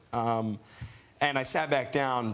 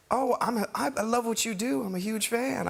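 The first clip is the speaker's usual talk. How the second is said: [name]'s voice; 9 semitones higher than usual. Retold or imitated. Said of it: "And he was like".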